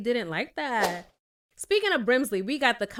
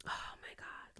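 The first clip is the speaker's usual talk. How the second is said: unvoiced, breathless whisper; a fan whispering, overcome by the scene; 17 dB quieter than usual. Real, not acted. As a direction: breathe it out, overwhelmed.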